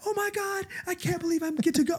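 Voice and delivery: giddy excitement. Retold or imitated. Invited guest reacting to the party invite, thrilled squeal. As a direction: gush like you just got the party invite. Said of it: "you had the reaction first".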